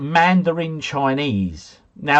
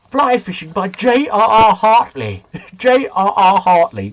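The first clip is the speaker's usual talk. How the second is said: mock wrong answer; 5 semitones higher, 5 dB louder than usual. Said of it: "No, no, no, no".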